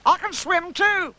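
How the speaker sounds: very high, slow boast; high-pitched childlike pride; proud little Roo. Acted. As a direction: pipe it proudly like a little one. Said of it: "said Roo proudly".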